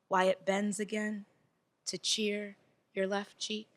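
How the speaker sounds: measured, intimate spoken-word reading with long pauses; tender poem read to a room, unhurried; love poem recitation. Acted.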